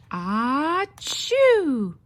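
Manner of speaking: loud sneeze rising high then falling; big sneeze. Acted. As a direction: let a huge achoo explode.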